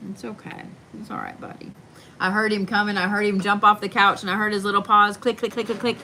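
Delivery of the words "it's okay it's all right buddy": near-whispered reassurance; soft murmur to a scared dog; hushed comfort. Real, not acted. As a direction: soothe him under your breath.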